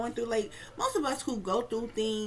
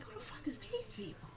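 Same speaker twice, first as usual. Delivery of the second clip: squeaky, high, hushed disbelief; a shocked little voice, pitched way up; incredulous; 12 semitones higher, 12 dB quieter than usual. Retold or imitated. Recalling the killers in the dream, high hushed disbelief. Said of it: "I'm like".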